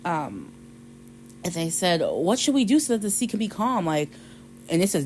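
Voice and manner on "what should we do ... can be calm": high-pitched anxious pleading; acted-out worried question; worried sailors. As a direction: ask in mock panic. Frightened sailors ask Jonah.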